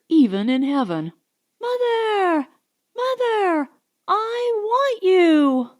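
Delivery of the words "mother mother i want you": put-on high little boy's voice, drawn out and wailing; child screaming. A narrator's reading. Bobbity screams for his mother in the night, high wailing child's voice. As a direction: cry for your mother like a small boy. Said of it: "Bobbity screaming that".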